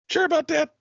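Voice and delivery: very high, squeaky-doubt repeat; exaggerated doubtful question.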